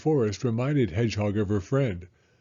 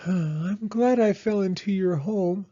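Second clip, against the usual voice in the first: high, grateful little creature voice; sighing Hedgehog; 8 semitones higher, 3 dB louder than usual. Acted. Hedgehog grateful for Mole's home, high sighing character voice. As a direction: sigh it gratefully in a small animal voice. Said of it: "Hedgehog sighed".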